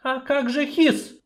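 high, slow, direct; point-blank question. Acted. Sorceress putting the question to the dwarf. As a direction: ask it flatly. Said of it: "в упор спросила Эльтара".

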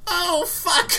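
high loud shocked cry; melodramatic dismay. Retold or imitated. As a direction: cry out like a tragic heroine. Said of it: "the transition from".

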